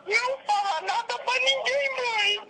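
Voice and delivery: high, pleading fake-scared daughter; mock panicked pleading. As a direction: cry out to mom like a hostage. Fake hostage begging mom.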